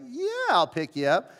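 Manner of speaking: mock-cheerful, put-on good-guy voice; feigned willingness. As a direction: agree while not really wanting to. Reluctant, playing the good guy.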